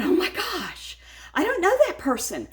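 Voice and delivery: high, alarmed inner voice; sudden shocked realization; startled gasp. Retold or imitated. Woman spots a stranger from her sink, startled and high.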